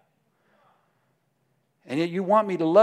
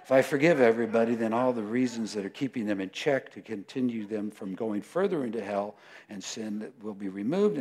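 usual speaking voice

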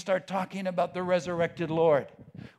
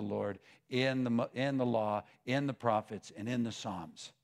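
tender preaching; 7 semitones lower than usual. Real, not acted. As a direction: speak gently and gravely to the beloved flock. Addresses congregation in hushed preaching.

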